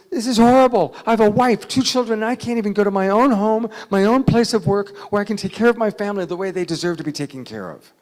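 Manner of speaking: loud, high, anguished outburst; still worked up. Retold or imitated. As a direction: cry out your grievance, easing off towards the end. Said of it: "he's still pretty worked up".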